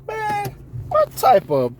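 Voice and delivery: high, slow disbelief at yourself; self-scolding. Retold or imitated. New boss scolding himself after a bad talk. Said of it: "think about that shit and be like".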